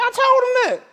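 ordinary talk, nothing marked

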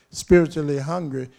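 loud, punched "spiritually hungry"; emphatic repetition. Real, not acted. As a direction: drive the phrase home loudly. Repeats the phrase forcefully for emphasis.

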